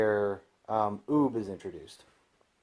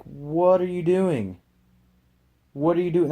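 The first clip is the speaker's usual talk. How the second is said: exasperated, pitched-up protest; incredulous exasperation; 6 semitones higher, 3 dB louder than usual. Retold or imitated. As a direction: stretch the question out high, as if scolding a fool. Reacting to Goku giving away button.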